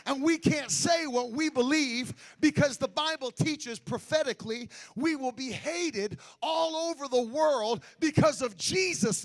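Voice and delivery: loud, rising sung preaching; full force preaching. Acted. Preacher shouting holiness at the congregation, loud and sung. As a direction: whoop it up to the congregation.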